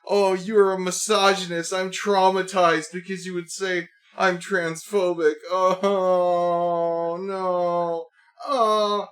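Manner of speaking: high, slow, exaggerated self-pity; mocking whiny terf. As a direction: whine like a fake-traumatized poster. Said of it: "terfs are like".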